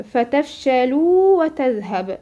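فتفشلوا وتذهب recited slowly; slow recitation. Shown as a demonstration. Teacher gives another verse example slowly. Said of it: "برضك هنا نفس الكلام".

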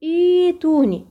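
إيتوني drawn slowly with long ii; slow recitation. Shown as a demonstration. Teacher slowly models إيتوني for students. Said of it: "بتنطق في الابتداء إيتوني".

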